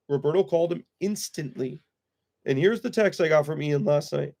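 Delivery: ordinary talk, nothing marked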